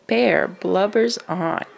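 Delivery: plain reading voice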